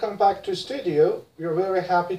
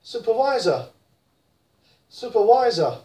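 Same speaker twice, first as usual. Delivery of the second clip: British supervisor, falling; British accent; 2 semitones higher than usual. Shown as a demonstration. Teacher models British supervisor: British accent. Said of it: "in British".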